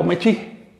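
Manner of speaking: "mechi" with a light breathy ch; slightly aspirated. Shown as a demonstration. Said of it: "Puede incluso tener un leve aspirado". ("mechi" con leve aspirado)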